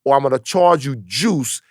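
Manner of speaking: a hammed-up gangster, drunk with power; Ben Lindbergh the loan shark. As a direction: squeeze him for the vig, slowly. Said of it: "He's like".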